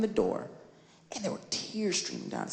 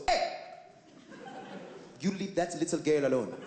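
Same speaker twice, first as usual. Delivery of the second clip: put-on Trinidadian babysitter; 3 semitones lower than usual. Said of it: "Trinidadian babysitter comes over and goes".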